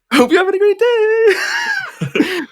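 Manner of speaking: very high, drawn-out well-wish; laughing, squeaky singsong; playful falsetto greeting. Pretend. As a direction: stretch it out in a silly falsetto. Playful greeting to an absent listener.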